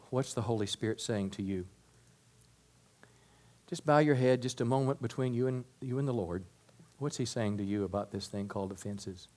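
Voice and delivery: soft, low, hushed pastoral voice; reflective prayer leading. Real, not acted. Pastor asking bowed heads to listen to God, reflective prayer leading. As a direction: gently lead them into prayer, low and quiet.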